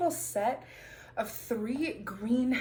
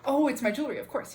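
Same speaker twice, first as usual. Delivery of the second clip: ordinary talk, nothing marked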